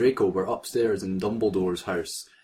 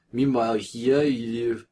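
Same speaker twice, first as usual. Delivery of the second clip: ordinary talk, nothing marked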